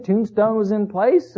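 ordinary delivery

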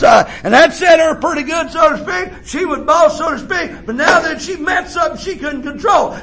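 high, ringing preacher's cry; preaching at full force. Acted. Preacher tells the church of his mother, full-force preaching. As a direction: preach it out to the congregation.

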